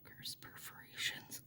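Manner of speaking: soft breathy whisper. Shown as a demonstration. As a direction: whisper it under your breath. Repeats the phrase, then whispers it.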